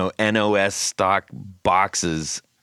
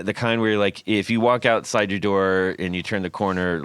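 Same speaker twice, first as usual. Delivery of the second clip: ordinary talk, nothing marked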